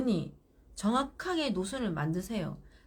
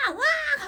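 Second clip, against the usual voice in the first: put-on child's wail; 7 dB louder, much slower than usual. Child bursts out crying.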